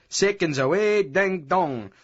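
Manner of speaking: high mocking boxing-bell call. A narrator's reading. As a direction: tease like a ringside announcer.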